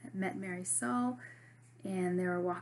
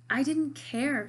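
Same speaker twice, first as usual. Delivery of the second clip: slower, louder cry of protest; 6 semitones higher, 9 dB louder, slower than usual. Acted. Marisol cries out at Jackson, covering her ears, loud and slow. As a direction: let it burst out, drawn out. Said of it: "she cried".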